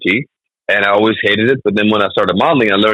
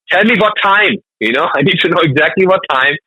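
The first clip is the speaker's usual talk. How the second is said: much higher, exasperated demand for an exact time; mock insistent German; 9 semitones higher than usual. German comic answering an American's "see you later", higher mock exasperation. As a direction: play the pedantic punctual German. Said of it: "And I'm like".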